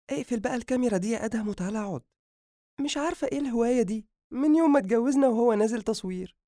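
high, very quick exasperated wife; chiding wife character. Acted. Wife complaining about her husband's filming, high rapid grumble.

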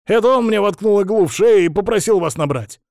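loud, high-pitched protest; indignant roar. A narrator's reading. Hostage accusing his captor over the phone, roaring. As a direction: blurt out the accusation at full voice.

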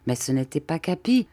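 ordinary delivery, nothing marked

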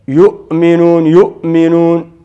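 يؤمنون with a firm catch after the first syllable; hamza cut off. Shown as a demonstration. Teacher showing that hamza cannot stretch, stopped short in يؤمنون. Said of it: "أن تطيل الصوت به".

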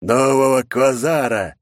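slow, loud triumphant growl. Acted. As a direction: drag it out like a villain. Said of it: "прорычал Орион".

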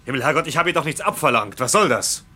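angry demand. Acted. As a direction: insist he finally come out with it. Krüger demands answers, exasperated.

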